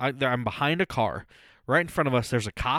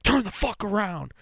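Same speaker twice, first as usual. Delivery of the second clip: barked order, pitched up; cop yelling; 8 semitones higher than usual. Retold or imitated. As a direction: yell it like an angry cop.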